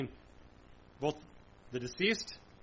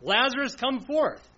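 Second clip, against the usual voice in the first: higher, louder calling out; Jesus's command; 6 semitones higher, 4 dB louder than usual. Retold or imitated. Jesus calling Lazarus from the tomb, loud command. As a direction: summon him out of the tomb. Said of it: "what Jesus said to Lazarus".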